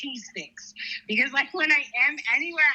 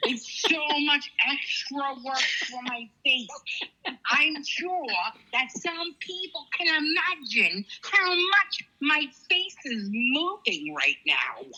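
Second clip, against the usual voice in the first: slow, over-articulated, laboured words; exaggerated enunciation; 6 semitones higher, slower than usual. Mocking her own struggle to enunciate, slow overdone diction. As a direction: make every syllable hard work, drawn out.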